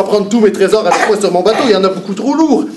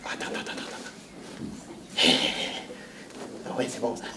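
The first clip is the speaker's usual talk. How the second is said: hushed whisper; 8 semitones lower, 18 dB quieter than usual. Acted. Pirate hushes the crowd so the parrot stays quiet, whispering. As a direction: whisper to keep the parrot asleep. Hushing before the trick.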